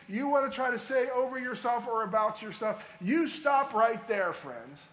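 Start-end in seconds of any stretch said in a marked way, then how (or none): 3.0-4.3 slow, loud and deliberate; preacher halting doubt with a slow loud command; firm command. Real, not acted. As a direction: stop them in their tracks, slow and loud. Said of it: "preacher commanding the congregation".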